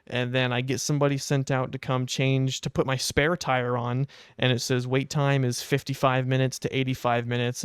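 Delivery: normal talking voice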